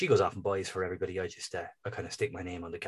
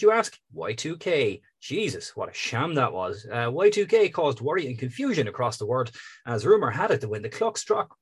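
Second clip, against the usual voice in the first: big, loud show-host read; announcer voice; 4 semitones higher, 7 dB louder than usual. Acted. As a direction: sell it like a radio presenter. Reads show intro announcer style.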